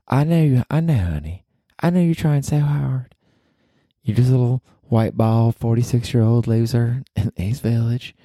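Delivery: high put-on Southern lady voice; Southern girlfriend. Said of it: "this is your girlfriend talking to you".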